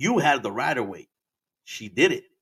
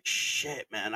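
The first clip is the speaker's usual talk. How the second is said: whispered curse; 3 semitones lower, 8 dB quieter than usual. Retold or imitated. Waiting for the cop.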